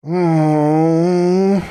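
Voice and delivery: high pained moan. A narrator's reading. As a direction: moan like the dying. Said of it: "Hoops's voice sounded more like the lamentations of the dead".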